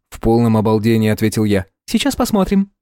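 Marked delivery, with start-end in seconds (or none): 1.8-2.7 high, sweet chirpy nurse voice; chirping. A narrator's reading. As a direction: chirp it like a cartoon princess. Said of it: "Колокольчик её голоса".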